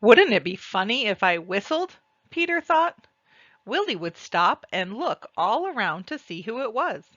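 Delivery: ordinary delivery, nothing marked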